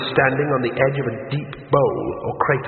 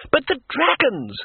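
much higher, slow, awed; entranced; 13 semitones higher than usual. Acted. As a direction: say it spellbound.

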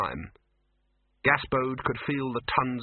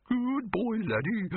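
cooing voice; 11 semitones higher, slower than usual. A narrator's reading. Laddie's cooing bark voice.

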